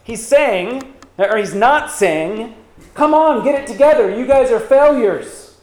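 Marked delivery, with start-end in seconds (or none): 2.9-5.6 high, loud mock scolding; raised voice, imitating a harsh rebuke. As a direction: act out an exasperated telling-off. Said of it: "Paul isn't rebuking them".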